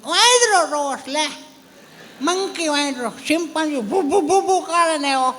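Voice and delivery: put-on high, slow little-boy voice; childish voice. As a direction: chatter like an excited kid. Child describing monkeys. (ઊંચો, ધીમો બાળકનો અવાજ)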